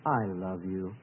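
low, soft murmur to a wife; husband's tender greeting. Acted. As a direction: say it low and softly to your spouse. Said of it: "walk up to your wife".

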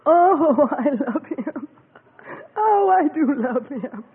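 half laughing, half crying; overcome, giggling tearfully; tearful laugh of love; laughing through tears. Real, not acted.

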